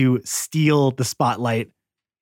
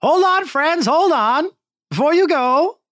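much higher, louder huckster voice; slick salesman; 6 dB louder, slower than usual. Acted. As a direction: call out like a carnival pitchman. GM voices salesman Scam Likely.

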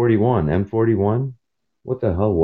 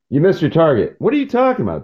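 indignant disbelief; 10 semitones higher, much faster than usual. Retold or imitated. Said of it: "quoted system and reply".

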